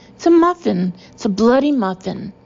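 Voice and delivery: exasperated outburst. Acted. Boss yelling at colleague who denies seeing muffins, exasperated. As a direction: hammer it home in a temper.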